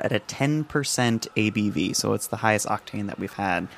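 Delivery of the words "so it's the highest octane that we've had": ordinary delivery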